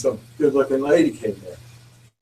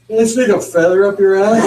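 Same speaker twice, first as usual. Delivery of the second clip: loud, laughing out the line; can't finish the punchline for laughing; laughing loudly; 8 semitones higher, 9 dB louder than usual. Real, not acted. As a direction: push the words through a big laugh. Teller laughs at punchline setup.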